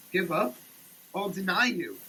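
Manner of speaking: normal talking voice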